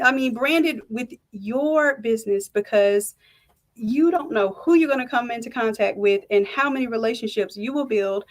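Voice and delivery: normal talking voice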